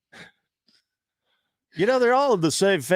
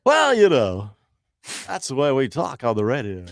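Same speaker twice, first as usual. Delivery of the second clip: fake DJ voice; 5 semitones lower, 3 dB louder than usual. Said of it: "likes your fake D J voice".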